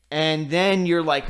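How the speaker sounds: normal talking voice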